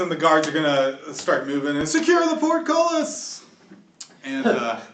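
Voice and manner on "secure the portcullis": high, drawn-out guard's call; shouted command. Acted. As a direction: call the order out across the square. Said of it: "some shouts".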